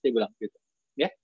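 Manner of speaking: ordinary talk, nothing marked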